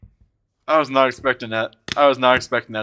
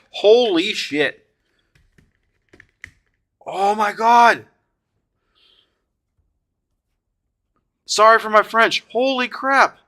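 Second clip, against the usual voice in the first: high, drawn-out cries of disbelief; awestruck excitement; 10 semitones higher, 4 dB louder, slower than usual. Real, not acted. Collector reacting to a rare pull, awestruck and high. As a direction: stretch it out high, blown away.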